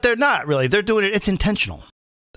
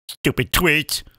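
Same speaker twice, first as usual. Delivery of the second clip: ordinary delivery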